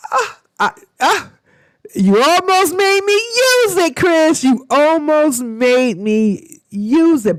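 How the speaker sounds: high, loud laughing cry of disbelief; laughing exclamation. Real, not acted. Vlogger laughing at Chris for nearly making her swear.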